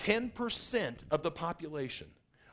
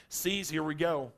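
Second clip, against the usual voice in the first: usual speaking voice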